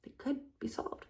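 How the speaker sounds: hushed whispered aside. Real, not acted. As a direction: drop right down to a whisper.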